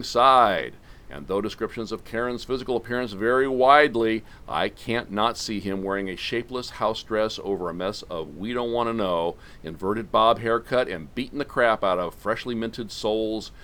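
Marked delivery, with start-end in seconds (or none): none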